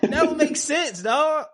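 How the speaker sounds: slow, very high-pitched disbelief; incredulous. Real, not acted. Host still stunned by twenty-hour flight, incredulous. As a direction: squeal it out, amazed.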